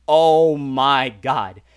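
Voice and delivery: very slow, loud, stretched out; stunned dread. Retold or imitated. Traveler seeing the red alarm screen, slow drawn-out mock dread. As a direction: draw out every word in retold dread.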